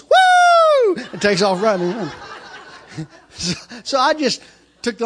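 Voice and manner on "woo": very high, loud "woo" shriek; piercing scream. Retold or imitated. Lady handing over an envelope, piercing scream. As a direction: shriek woo at full volume. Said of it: "she goes".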